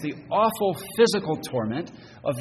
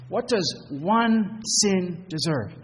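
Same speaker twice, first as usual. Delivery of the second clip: slow question; 5 semitones higher, much slower than usual. Acted. Rhetorical question to congregation.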